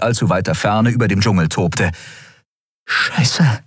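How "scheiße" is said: plain reading voice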